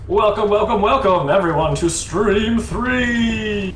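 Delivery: booming high announcer hype, very drawn out. Pretend. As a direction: welcome the crowd like a fight announcer. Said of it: "with my best adventure voice, er, adventure, announcer voice".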